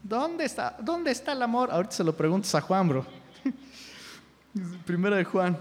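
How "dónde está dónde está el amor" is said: high, plaintive mock question; mock complainer. Pastor mimicking people asking where the love is, mock complaining. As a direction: raise the pitch like someone protesting.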